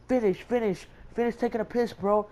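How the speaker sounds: high-pitched hurrying of a friend; urgent urging. Retold or imitated. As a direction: imitate a student urging his buddy along. Students urging each other, retold.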